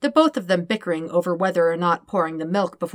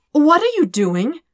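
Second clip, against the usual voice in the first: high, slowed words with rising panic; edge of panic; 9 semitones higher, 6 dB louder than usual. Acted. Dirk watching Todd cradle the baby, panicky. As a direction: let panic creep into it. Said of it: "an edge of panic in his voice".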